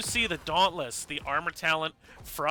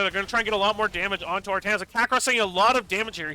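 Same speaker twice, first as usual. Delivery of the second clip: fast, louder, higher play-by-play; excited; 5 semitones higher, 7 dB louder, faster than usual. Real, not acted. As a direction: ramp up the hype on the fight.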